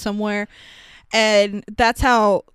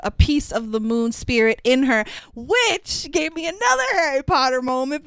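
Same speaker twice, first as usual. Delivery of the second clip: usual speaking voice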